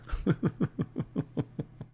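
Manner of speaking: wheezy, rhythmic breathy laugh; soft staccato giggle like spitting beats; beatboxing chuckle. Real, not acted. Streamer tickled by the vape o'clock rhyme, breathy laughing. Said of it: "Spittin' beats".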